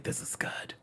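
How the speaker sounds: breathy whisper, voice off; whispered awe. Real, not acted. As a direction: hush it like a secret delight. Excited whisper over good editing.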